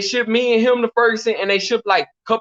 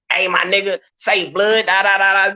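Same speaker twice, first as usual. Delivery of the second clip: usual speaking voice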